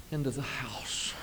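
breathy whispered aside; hushed under the breath. Retold or imitated. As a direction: drop to a whisper as she trudges in. Trudging neighbor, hushed aside.

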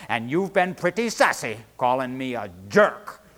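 put-on loud, angry old man. A narrator's reading. Old man of the sea scolding Charlie, furious. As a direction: build to a loud, offended accusation. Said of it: "madder than a hornet".

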